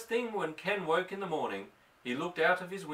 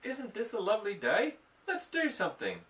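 cheerful cat; 7 semitones higher than usual. Acted.

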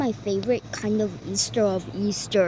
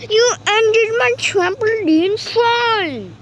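indignant; 9 semitones higher, 5 dB louder, slower than usual. Real, not acted. Trampoline fun cut short.

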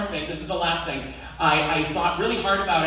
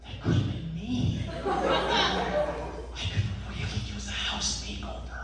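softer, half-whispered realization; whispered self-talk; hushed inner thought; 9 semitones higher, 5 dB quieter than usual. Retold or imitated. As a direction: mutter it to yourself, stunned. Inner thought watching the show.

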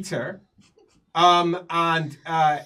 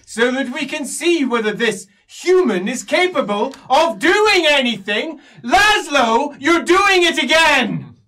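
raised, louder, exasperated scolding; irritated elf; 9 semitones higher, 11 dB louder than usual. Acted. Elf scolds Laszlo loudly in front of the court, exasperated. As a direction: snap at the scholar, loud and high. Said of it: "as the elf says".

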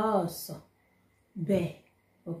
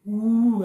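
loud mock howl; playful wolf howl; 3 semitones higher, 10 dB louder than usual. Teacher imitates wolf howl.

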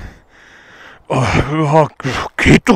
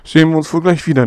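normal talking voice

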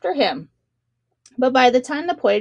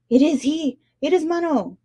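higher, excited cry of recognition; a king startled into calling out; awed cry; 5 semitones higher than usual. A narrator's reading. Shark king cries out in awe at the passing shadow. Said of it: "cried the king of sharks".

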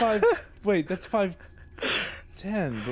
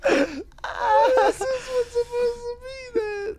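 laughing; 10 semitones higher, 3 dB louder than usual. Real, not acted. Hysterical laughing over list.

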